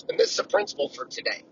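ordinary talk, nothing marked

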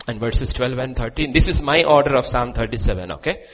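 booming pulpit reading; loud sermon reading. Real, not acted. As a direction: declare each word of scripture. Loud, slow psalm reading.

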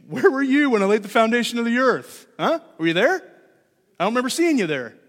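sarcastic. Acted. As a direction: challenge them with mock questioning. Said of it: "maybe with a little bit of sarcasm".

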